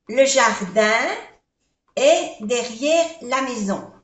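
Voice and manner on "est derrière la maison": usual speaking voice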